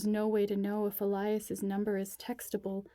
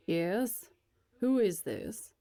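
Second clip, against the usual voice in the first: wary, guarded questions; suspicious; 3 dB louder, slower than usual. A narrator's reading. Old man on the phone, suspicious of the caller. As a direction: sound distrustful of a stranger. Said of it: "He sounds suspicious as hell".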